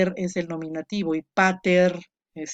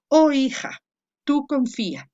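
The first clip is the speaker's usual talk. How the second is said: "Oh hija, tú confía" called out louder and higher; exclaimed address; 7 semitones higher, 4 dB louder than usual. Shown as a demonstration. Teacher models the vocative for students, louder call. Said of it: "Entonces aquí dice".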